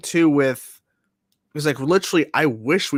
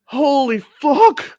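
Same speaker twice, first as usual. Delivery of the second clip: high, loud, drawn-out yell; excited shout; 11 semitones higher, 6 dB louder, much slower than usual. Real, not acted. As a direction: stretch it out thrilled. Excited at twist.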